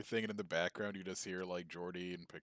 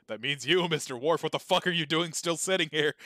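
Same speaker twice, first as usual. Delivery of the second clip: louder, higher imitation of Picard barking an order; captain yelling at an officer who hasn't moved, loud and a bit higher; mock captain yell; 8 semitones higher, 13 dB louder than usual. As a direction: bellow it like a fed-up captain.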